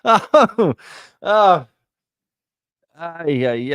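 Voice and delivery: high, loud gasps of laughter; burst of laughter. Real, not acted. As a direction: crack up out loud. Cracking up at a comment.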